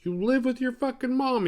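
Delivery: high-pitched retold jab; high mimicked put-down; mock accusation. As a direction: throw it out as a high, mocking accusation. Retold scornful accusation in argument.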